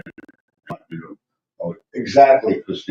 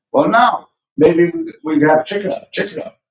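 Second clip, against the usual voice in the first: mocking husband; 10 semitones higher than usual. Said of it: "And he says".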